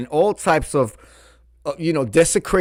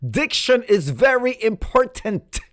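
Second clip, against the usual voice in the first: much higher, mock-prim voice quoting her husband; prissy diction-coach impression, pitched way up; imitating Incredible Hulk; 11 semitones higher, 3 dB louder than usual. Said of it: "Incredible Hulk said".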